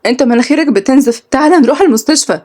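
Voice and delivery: anxious questions at his bleeding nose; frightened. Acted. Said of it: "قالت بخوف".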